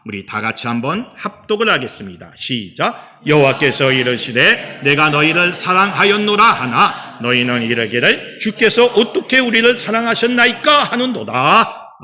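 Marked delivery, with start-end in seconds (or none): 3.3-12.0 loud, declaimed congregational reading; scripture recitation. Acted. Pastor leading the congregation in Malachi, solemn declamation. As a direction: lead a joint reading, voice raised.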